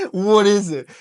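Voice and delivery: loud, high-pitched coach's question; exasperated demand. Retold or imitated. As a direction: burst out with a retold impatient question. Coach confronting upset teen, retold.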